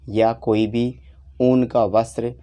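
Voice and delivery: ordinary talk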